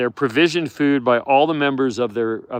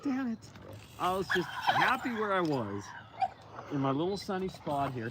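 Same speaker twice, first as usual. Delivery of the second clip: a hint of a higher, soft grumble; grumpy wolf voice; 6 semitones higher, 11 dB quieter than usual. Owner voicing the wolf annoyed at the pups. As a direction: grumble it, a bit put out. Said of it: "he's like".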